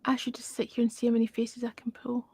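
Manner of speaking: soft unvoiced whisper. Real, not acted. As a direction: whisper it like a secret. Late-night aside to stream.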